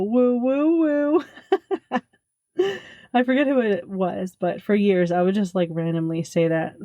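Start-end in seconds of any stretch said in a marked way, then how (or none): 0.0-2.0 high wrestler woo chant. Retold or imitated. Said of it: "it would go". 2.6-4.4 laughing through the words. Real, not acted. Laughing after the imitation.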